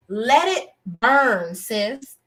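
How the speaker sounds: slow, drawn-out let it burn. Real, not acted. Reviewer urging the show to end the storyline, drawn out slowly. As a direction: stretch each word, let it burn.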